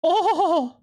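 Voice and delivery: high, laughing squeals of surprise; surprised squeal. Real, not acted. Streamer reacting to a shocking food pick, squealing high. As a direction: let out two delighted high oh's. Sudden reaction to game item.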